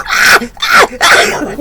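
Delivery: high, loud distressed ah ah ah; upset child cries. Retold or imitated. Daughter with lollipop stuck in hair, high distressed cries.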